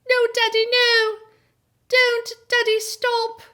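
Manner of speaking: put-on frightened little English boy crying out to Daddy; little English boy pleading. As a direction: beg like a small English boy stopping his father. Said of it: "In this beautiful little English accent".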